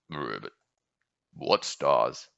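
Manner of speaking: low frog croak. Acted. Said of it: "asked the frog".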